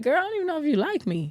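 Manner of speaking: high, quick, put-out complaint; mock complaining partner. Partner complaining to her, high and rushed mock whine. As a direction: rush it out like a hurt partner.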